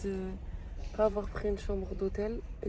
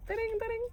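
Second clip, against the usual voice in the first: high sung phone ringing; phone ring imitation; 12 semitones higher, 4 dB quieter than usual. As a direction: ring like a telephone. Prank call ringtone imitation.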